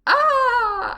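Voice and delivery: high, drawn-out "ah" imitating the cashier; touched reaction. Cashier reacts to shouted compliment, high imitated coo.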